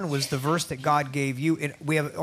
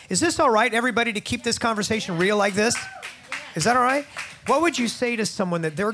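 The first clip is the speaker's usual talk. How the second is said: higher and louder, asking the crowd's okay; calling out to audience; 7 semitones higher, 4 dB louder than usual. Real, not acted. Host asking the studio audience for permission, higher and louder. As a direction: lift the voice out to the room.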